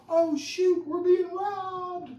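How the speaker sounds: high, loud squeal of alarm, slow on the last words; hammed-up terror at a robbery; mock panic. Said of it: "had been like".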